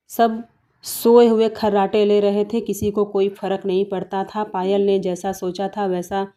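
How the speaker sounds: ordinary delivery, nothing marked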